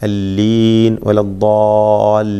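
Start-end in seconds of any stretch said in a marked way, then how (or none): 1.1-2.3 വലദ്ദാലീൻ recited slowly with the long ലീ drawn out in Quran style; recited Quranic word. Shown as a demonstration. Tajweed teacher showing students വലദ്ദാലീൻ, slow and drawn out. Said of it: "ഏത് ദീർഘം?".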